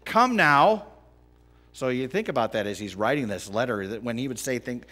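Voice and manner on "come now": loud scripture call. Acted. Reading scripture with force.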